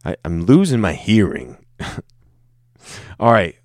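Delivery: ordinary delivery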